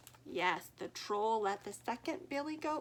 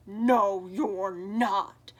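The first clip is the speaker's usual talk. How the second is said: loud roaring troll, far louder; 3 semitones lower, 11 dB louder, much slower than usual. Acted. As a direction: roar it down at the goat. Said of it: "roared the troll".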